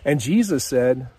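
ordinary talk

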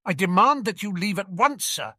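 high, indignant demand; Uncle Vernon. Acted. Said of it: "Uncle Vernon demanded, making a funny rasping noise".

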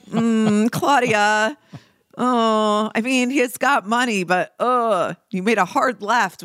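high, doubtful mock sympathy; doubtful teasing tone; skeptical. Everyone's skeptical reaction, voiced.

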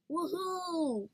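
high gleeful whoop; cheering. A narrator's reading. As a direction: cheer like a happy kid. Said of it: "Poopskin cheered".

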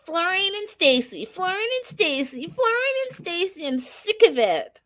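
mocking whine. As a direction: mimic a fed-up sister whining the couple's name. Mocking sister's couple name repeatedly.

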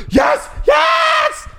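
high, loud thrilled yes; excited yelling. Retold or imitated. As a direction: yell yes with delight. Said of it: "And I was like".

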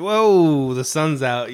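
loud, high drawn-out whoa, then easing; playful exclamation of surprise; mock astonishment. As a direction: act out being startled by daylight. Acts out stepping outside surprised.

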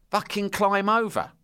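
mock order. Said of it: "They went".

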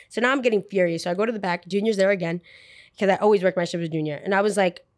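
ordinary delivery

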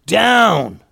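loud, high, urgent shout; Duke's warning yell. A narrator's reading. Duke warns the group of incoming cannon fire, urgent yell. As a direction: yell a sudden warning to take cover. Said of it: "yelled Duke".

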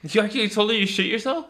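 high, loud shocked question; can't believe he told her; disbelief. Real, not acted. Shocked at friend's confession.

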